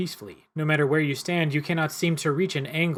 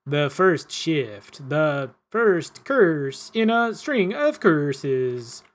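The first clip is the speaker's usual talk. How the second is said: very slow, louder, long drawn phrases; unearthly stranger; 5 dB louder, much slower than usual. Acted. Game master voicing the stranger's warning to the player, very slow and unearthly. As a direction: intone it like a cursed being. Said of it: "grating, unearthly cadence".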